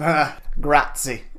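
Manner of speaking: slow mock Italian grazie; hammed-up Italian, drawn out; fake foreign language. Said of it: "You do the German".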